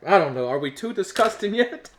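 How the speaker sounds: usual speaking voice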